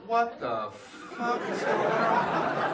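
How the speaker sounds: high, slow bewildered chorus; mock slow confused group voice; class in unison. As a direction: drawl it out like a stunned class. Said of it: "Whole class all together, just".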